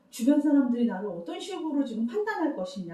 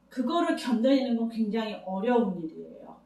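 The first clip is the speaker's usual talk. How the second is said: ordinary talk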